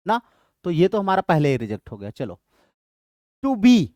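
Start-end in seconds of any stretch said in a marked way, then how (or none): 3.4-3.9 "to be" with weak "to", strong "be"; stress on BE. Shown as a demonstration. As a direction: scan "to be" as an iamb, landing on "be". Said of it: "to unstressed, be stressed". ("to be" में "be" पर ज़ोर)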